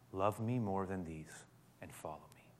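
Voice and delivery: a hushed whisper of Jesus; whispered invitation. Acted. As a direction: whisper it tenderly.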